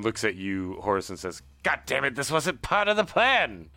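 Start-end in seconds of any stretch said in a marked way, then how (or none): 1.6-3.7 higher, louder exasperated cry; frustrated outburst. Acted. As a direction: yelp with indignation from the floor. Geralt exclaims after being hit.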